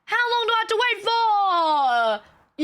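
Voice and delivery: high, drawn-out exasperated wail; hammed-up complaint at being kept waiting; exasperated mock outburst. Acted outburst at receptionist.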